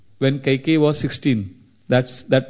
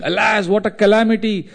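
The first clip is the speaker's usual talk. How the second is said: high, raised cry of despair; mock anguished scream; 7 semitones higher than usual. A narrator's reading. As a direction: wail out the disaster. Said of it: "he started screaming".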